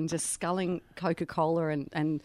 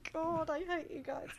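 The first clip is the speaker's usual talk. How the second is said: high-pitched laughing protest; giggling mock complaint; 9 semitones higher, 12 dB quieter than usual. Real, not acted.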